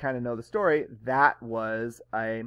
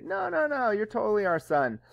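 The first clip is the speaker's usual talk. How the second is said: protesting parents; 8 semitones higher than usual. Retold or imitated. Adoptive parents denying adoption.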